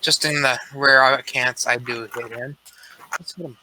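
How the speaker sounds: usual speaking voice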